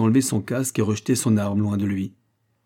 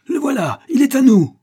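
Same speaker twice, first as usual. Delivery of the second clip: very high, loud shout; excited cry; 8 dB louder than usual. A narrator's reading. Millionaires closing in on the spy, high shout. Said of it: "s'écrièrent-ils".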